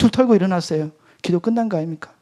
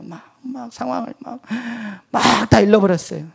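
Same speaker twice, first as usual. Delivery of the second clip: put-on tattling child; 5 semitones higher than usual. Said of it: "엄마한테 이르는 일곱 살 아이처럼".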